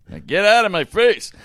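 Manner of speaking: high, loud brush-off bark; a father shouting his son away; dismissive shout. Retold or imitated. Dad dismissing son, retold.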